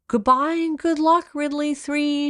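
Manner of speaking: high, loud goodbye call; mother shouting up at a departing plane; shouted farewell. A narrator's reading. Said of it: "shouted Mother".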